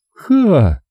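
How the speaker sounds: a high, wicked little "ha"; a high girlish mocking "ha"; wicked laugh. A narrator's reading. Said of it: "Её смешок".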